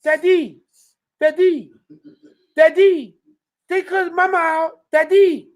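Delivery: put-on high, frantic child calling Daddy; panicked kid. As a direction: play the kid crying out to his father. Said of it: "Junior say".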